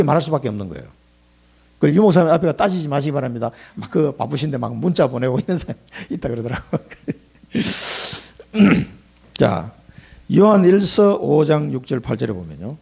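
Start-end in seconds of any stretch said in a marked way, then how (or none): none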